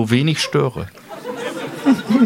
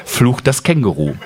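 ordinary talk

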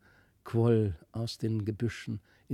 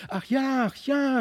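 put-on eager children; 11 semitones higher than usual. A narrator's reading. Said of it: "riefen beide".

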